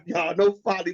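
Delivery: ordinary delivery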